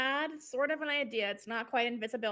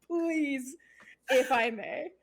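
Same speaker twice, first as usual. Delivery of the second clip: laughing; 3 semitones higher, 4 dB louder, much slower than usual. Real, not acted. Player laughing at own plan.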